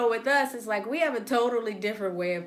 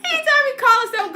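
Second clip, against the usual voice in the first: laughing; 13 semitones higher, 6 dB louder than usual. Real, not acted.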